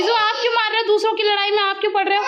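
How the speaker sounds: high-pitched parental telling-off; exasperated mother breaking up a fight; scolding. Real, not acted.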